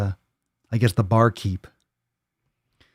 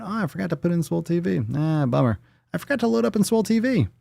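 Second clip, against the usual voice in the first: rushed; 8 semitones higher, faster than usual. Real, not acted. Rushed fake panic.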